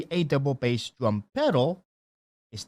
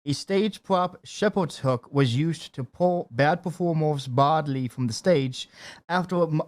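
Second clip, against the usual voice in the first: ordinary talk, nothing marked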